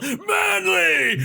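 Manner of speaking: high, hammed-up deathcore shout; mock metal yell. Joking about what deathcore singers yell before breakdowns, mock yell. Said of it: "if they yelled".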